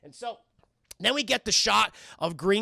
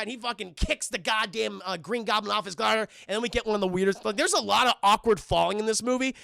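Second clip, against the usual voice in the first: normal talking voice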